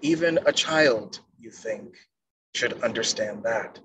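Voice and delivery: normal talking voice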